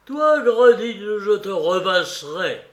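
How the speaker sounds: derisive, menacing outburst; sneering threat. A narrator's reading. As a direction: jeer it out high and loud. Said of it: "dans un ricanement".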